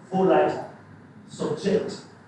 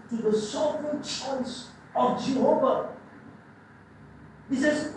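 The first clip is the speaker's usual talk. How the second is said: ordinary delivery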